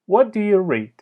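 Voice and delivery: "What" starts high and the voice falls to "read"; falling question tune. Shown as a demonstration. Teacher showing falling wh-question intonation, high then falling. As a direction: open high on "What", let it drop by the end. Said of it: "در ابتدا تون صدا بالا هست".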